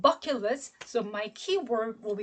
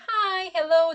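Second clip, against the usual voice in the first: bright high-pitched greeting, louder; 6 semitones higher, 5 dB louder than usual. Shown as a demonstration. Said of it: "that'll be high tone".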